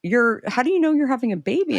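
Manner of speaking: quick, high startled question; imitating surprised clerk. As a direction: play the store guy caught off guard. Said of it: "And he said".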